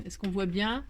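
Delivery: ordinary delivery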